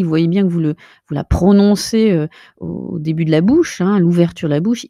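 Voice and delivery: normal talking voice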